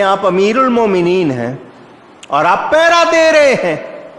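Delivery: high, rising voice of disbelief; astonished question. Retold or imitated. People astonished at caliph standing guard. (حیرت بھرا اونچا سوال)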